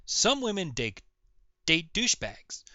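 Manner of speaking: ordinary delivery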